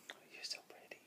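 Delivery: adoring whisper. Real, not acted. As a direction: hush it with affection. Admiring baby tenderly.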